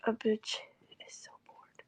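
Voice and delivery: whispered, voiceless and soft. Real, not acted. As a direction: confide it under your breath. Whispered aside about boredom.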